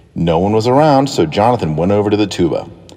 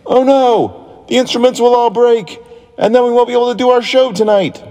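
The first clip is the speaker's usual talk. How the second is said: panicked conductor. A narrator's reading. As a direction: cry out in dismay. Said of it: "The orchestra conductor ran in".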